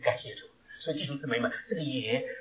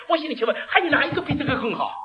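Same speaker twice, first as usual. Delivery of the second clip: higher, laughing rhetorical question; a delighted preacher asking it again, more excited; 11 semitones higher than usual. Real, not acted.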